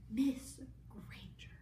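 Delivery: hissed disapproving whisper; mock stern hushed scolding. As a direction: breathe it out like a shocked headmistress. McGonagall scolding Hermione.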